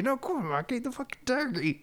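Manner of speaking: high, exasperated coaxing, acted out; insistent goading. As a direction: imitate someone pushing harder, voice way up. Acting out character's escalating goading.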